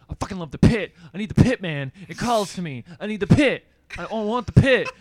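loud, punched cries of devotion; worked-up fanatic. Retold or imitated. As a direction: imitate a fired-up stranger raving in bursts. Acting out the worked-up pit fanatic.

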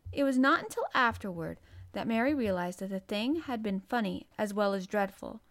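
usual speaking voice

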